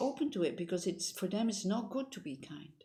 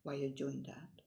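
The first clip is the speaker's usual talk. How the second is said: mocking naive jab; 4 semitones lower, 5 dB quieter than usual. Critics scoffing at her kindness, condescending. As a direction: sneer it like a doubter dismissing kindness. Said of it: "when people say".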